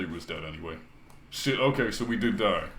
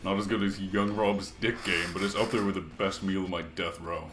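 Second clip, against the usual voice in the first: usual speaking voice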